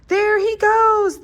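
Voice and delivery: high, loud, slow cheer; excited cheer. A narrator's reading. Animals spotting the shooting star, loud joyful cheer. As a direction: call out with joy to the sky. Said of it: "the animals cheered".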